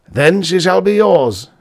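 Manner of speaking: loud, firm promise; decisive. Acted. Professor granting his daughter to the student, decisive and loud. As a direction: settle it with decision. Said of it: "the physiologist said with decision".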